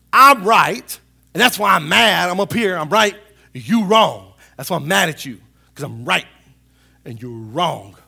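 loud indignant declaration, easing toward the end; acted self-righteous anger. Person feeling morally superior to an opponent, mock angry. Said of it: "feel angry about things".

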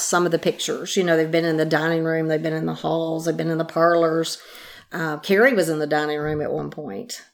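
normal talking voice

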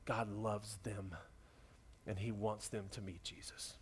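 hushed, breathy whisper; tender whispered close. Real, not acted. Quiet close of the appeal.

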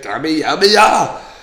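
"Kami, Yami" building to a very high, loud "ah!"; hammed-up anime power yell; mock battle cry. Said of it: "That's what he should say when he shoots it".

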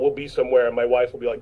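ordinary talk, nothing marked

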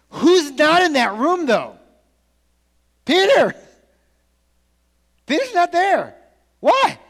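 high, loud emphatic call; preaching shout. Real, not acted. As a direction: drive the point home to the crowd. Preacher builds to climax.

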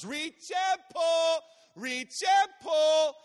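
high, drawn-out rhythmic yell; loud, high-pitched, slow chanted shout; top-of-lungs child shout. Retold or imitated. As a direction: imitate a little girl belting out her swim chant. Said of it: "at the top of her lungs".

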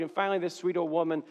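normal talking voice

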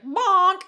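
loud, high comic bonk; mock cartoon bonk. Mocking the movie's head-hit sound, loud cartoon bonk. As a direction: pop it out like a cartoon sound effect. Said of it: "he hits her and he's like".

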